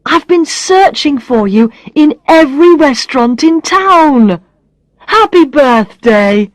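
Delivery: high-voiced, exuberant relief at finding someone; excited greeting. A narrator's reading. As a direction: burst in with birthday wishes. Barry finally finds birthday friend.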